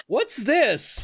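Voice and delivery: high, puzzled prince; mock surprise. Prince Charming finds surprise.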